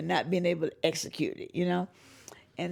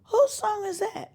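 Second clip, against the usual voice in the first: high, slow wondering fan voice; amazed fan; 3 dB louder, slower than usual. Retold or imitated. Fan hearing singer's song, high slow amazed voice. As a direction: ask in awe.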